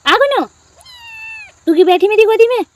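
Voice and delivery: high, loud cooing at the cat. Real, not acted. Girl gushes at Gunnu in her lap, high baby talk.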